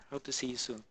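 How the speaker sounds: ordinary delivery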